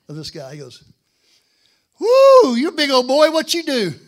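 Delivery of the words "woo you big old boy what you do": very loud, high country holler; country boy impression. Country boy greeting his seatmate, hollering in high drawl. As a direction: whoop it out like a big country boy. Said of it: "do my best country impression".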